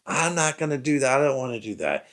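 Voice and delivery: put-on high, whiny voice of a defiant kid refusing. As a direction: play the bratty son talking back. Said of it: "very defiant and disrespectful".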